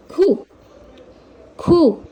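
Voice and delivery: खू with a long oo; syllable demo. Shown as a demonstration. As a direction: say खू clearly, long oo. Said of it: "ये बड़े ऊ की मात्रा". (खू लंबे ऊ के साथ)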